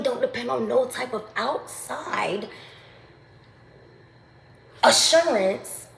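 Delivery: fired-up preaching. Real, not acted. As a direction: preach it like a sermon at full pitch. Preaching climax on self-reliance.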